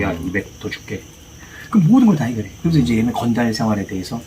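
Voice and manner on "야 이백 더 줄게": low, slow, offhand boss voice; a hint of a lazy gang boss; lightly voiced gang boss. Boss voiced in retold scene.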